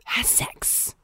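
whispered punchline; whispering. Pretend. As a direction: hush it out. Whisper joke callback.

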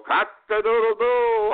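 loud, very high, slow rooster crow. Retold or imitated. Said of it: "the rooster go".

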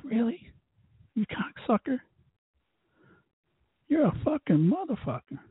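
high hushed sarcastic jab; muttered insult. Retold or imitated. Insulting the staff under his breath, high and hushed. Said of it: "I'm like".

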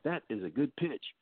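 quietly pleased with himself, "That is a good pitch" said as self-admiration; a hint of smug surprise at his own pitch; smug inner voice. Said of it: "You're like".

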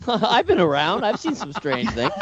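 amused, laughing through the words; laughing echo. Real, not acted. Guest echoes host's teasing line.

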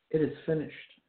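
a firm, strong proclamation; powerful voice. Shown as a demonstration. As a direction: declare it with victory, not fading. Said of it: "in a powerful voice, in a power-filled voice".

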